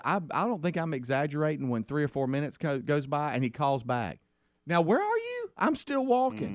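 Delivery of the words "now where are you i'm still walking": put-on much higher exasperated kid; impatient son. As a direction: whine where are you like a tired son.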